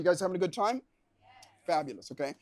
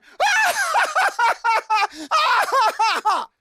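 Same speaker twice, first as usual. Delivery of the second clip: huge high shouted belly laugh; hammed-up laugh at a sitcom joke; mock roaring laugh; 8 dB louder than usual. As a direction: bust out laughing as loud as you can. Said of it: "have you ever gone".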